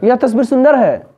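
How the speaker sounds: high, loud cry of amazement; astonishment. Shown as a demonstration. Teacher repeating the exclamation for students, loudly astonished. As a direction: exclaim in wonder, dropping at the end. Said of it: "ये आश्चर्य हो गया".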